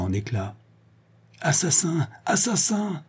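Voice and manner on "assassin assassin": crier bien plus aigu, terrifié; le père accuse son agresseur; cri d'effroi. A narrator's reading. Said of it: "cria-t-il". (cri terrifié, voix bien plus haute)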